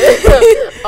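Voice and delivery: high, loud burst of teary laughter; tearful laugh. Real, not acted. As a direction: laugh on the edge of crying. Overwhelmed at own farewell.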